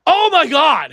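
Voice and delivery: high, loud "oh my God" of disbelief; starstruck gasp. Retold or imitated. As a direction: gasp it like you can't believe who it is. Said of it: "And I was like".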